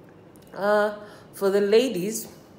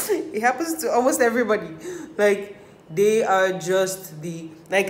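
talking through laughter; 4 semitones higher, 4 dB louder than usual. Real, not acted. Still laughing while talking.

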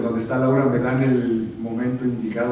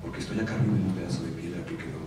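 Bruce Willis voice; 5 semitones lower, 4 dB quieter than usual. Retold or imitated. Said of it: "y le dice".

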